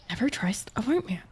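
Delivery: breathy conspiratorial whisper. Real, not acted. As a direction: whisper it like a secret warning. Dark joke aside to chat.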